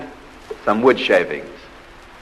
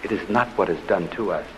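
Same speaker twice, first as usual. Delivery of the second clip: normal talking voice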